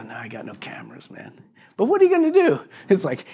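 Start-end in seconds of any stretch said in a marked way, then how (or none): 0.0-1.3 whispered, much softer; a whispered reply to the neighbor. Retold or imitated. Answering neighbor about cameras. 1.8-2.6 high, shrugging what are you gonna do; high exasperated question. Retold or imitated. Retelling talk with neighbor.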